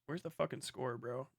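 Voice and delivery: ordinary talk, nothing marked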